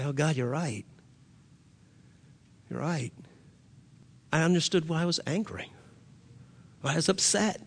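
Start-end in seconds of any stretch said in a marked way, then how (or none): 0.0-3.1 quiet realization. Retold or imitated. Man admitting to God he was right, quiet realization. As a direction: concede it quietly, almost to yourself.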